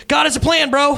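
high, over-earnest quarterback; mock pep talk. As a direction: chirp empty encouragement at a hurt teammate. Quarterback's clueless encouragement, mocked.

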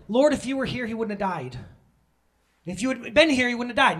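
high, loud reproach; upset Martha. Acted. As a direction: act out Martha confronting Jesus. Martha confronting Jesus in the story.

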